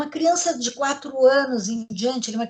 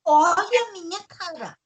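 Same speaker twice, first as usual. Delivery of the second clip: serious hurt mother; 7 semitones higher than usual. Shown as a demonstration. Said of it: "séria".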